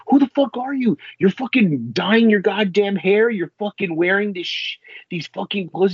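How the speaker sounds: hammed-up scornful tirade; mock tirade. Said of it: "Bernholtz like".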